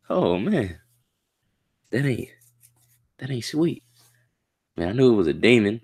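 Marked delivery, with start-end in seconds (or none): 0.1-3.8 high, softer dismay; lingering shock. Real, not acted. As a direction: let the shock fade out, still pitched up. After the shock.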